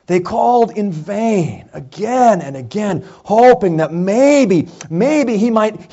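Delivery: ordinary delivery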